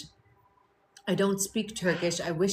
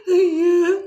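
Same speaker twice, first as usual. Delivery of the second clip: high, loud silly prank yell; weird voice; 10 semitones higher, 10 dB louder than usual. Retold or imitated. Imitating TikTok pranksters yelling behind shoppers. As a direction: shout a goofy voice behind someone. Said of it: "they make these weird voices".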